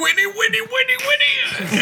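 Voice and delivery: very high shrieked mock neigh; horse whinny. [name] finishes turning into a racehorse, shrieked whinny. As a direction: squeal the words like a whinnying horse. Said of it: "starts turning into a fucking racing horse".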